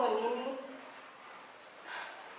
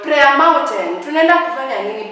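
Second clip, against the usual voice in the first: indignant cry; outraged disbelief; 4 semitones higher, 8 dB louder than usual. Real, not acted. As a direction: stretch it out, louder, in disbelief. Outraged at the date idea.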